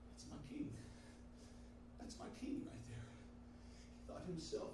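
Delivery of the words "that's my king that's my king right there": weeping whisper. A narrator's reading. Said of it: "the man began to cry".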